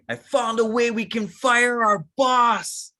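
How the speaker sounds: mock-excited announcement; thrilled reveal. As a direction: sell the dream to a friend. Acted excited pitch to friend.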